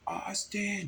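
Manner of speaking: high-pitched drawn-out call; re-enacted yell. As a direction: holler the name as if from a distance. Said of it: "I yelled out".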